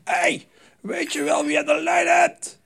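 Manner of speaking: high, loud indignant yell; imitating a furious caller; angry shout. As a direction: bark it down the phone like an offended caller. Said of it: "En toen werd hij heel boos".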